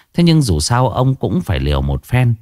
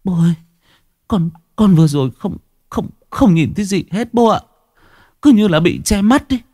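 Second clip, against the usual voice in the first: higher, shaken stammer; 9 semitones higher than usual. Acted. Daughter-in-law stammering to her father-in-law after the near crash. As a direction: stumble over the words in shock. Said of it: "lắp bắp".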